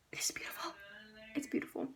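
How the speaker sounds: hushed whisper of awe; soft awed whisper. Real, not acted. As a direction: breathe it out in a whisper. Awed whisper over quote.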